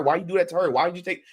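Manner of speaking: normal talking voice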